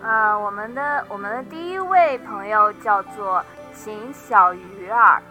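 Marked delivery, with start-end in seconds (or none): none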